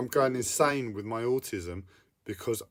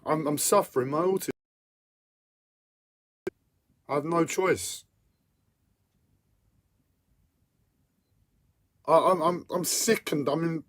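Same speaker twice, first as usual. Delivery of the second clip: higher and louder, whining in real distress; whiny voice; 6 semitones higher, 6 dB louder than usual. Real, not acted. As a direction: plead through the overwhelm, voice pitched up. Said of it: "my whiny fucking voice".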